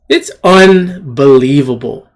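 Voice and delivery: ordinary talk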